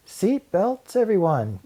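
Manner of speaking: high, loud call to the class; teacher calling her class to buckle up. A narrator's reading. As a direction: sing it out high to the whole class. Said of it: "called the Frizz".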